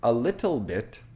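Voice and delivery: "little" with a released T, no flap; hard T in little. Shown as a demonstration. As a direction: sound the T clearly in "little". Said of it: "so if somebody says".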